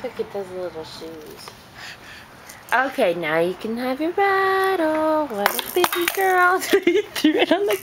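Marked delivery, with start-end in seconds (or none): 0.0-1.5 hushed, tender cooing; soft cooing. Real, not acted. Cooing over baby shoes.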